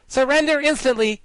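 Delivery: high, loud little general demanding surrender; a pretty girl trying to sound fierce; stern girl general. Acted. General Ginger orders the guardian, high mock-stern command. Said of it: "frowning as terribly as her pretty face would allow".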